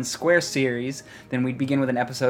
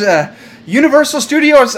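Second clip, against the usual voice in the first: loud announcement; 14 semitones higher, 11 dB louder than usual. Pretend. Fake reveal, loud.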